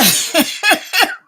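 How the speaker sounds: high, bright burst of laughter. Real, not acted. Laughing at bell bottoms again.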